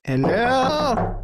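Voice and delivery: high calling hello; character voice. Acted. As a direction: call out in character. Character greets at door.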